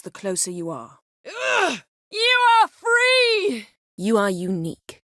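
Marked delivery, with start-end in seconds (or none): 1.3-1.8 a sharp, high "ugh" of effort; strained high grunt. Acted. Cry of effort or disgust. 2.1-3.7 high, drawn-out triumphant shout; booming magical proclamation; slow ringing shout. Acted. Casting spell, triumphant proclamation.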